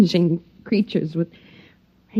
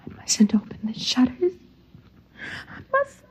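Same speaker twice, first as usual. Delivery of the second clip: tense whisper; 3 semitones higher, 7 dB quieter than usual. Acted. Fighting temptation.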